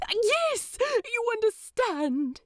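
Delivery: a delighted angel, voice lifted high. Acted. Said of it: "lights up with delight".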